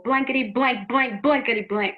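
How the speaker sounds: punchy stand-in swearing; mock cursing. Frustrated person with no vocabulary, mock cursing. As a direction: rattle off censored curses with mild heat.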